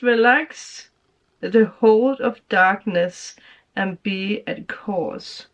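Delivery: slow, deliberate reading of the card; drawn-out, solemn reading of the message. Real, not acted. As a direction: read the card out slowly and weightily. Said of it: "the card that we have".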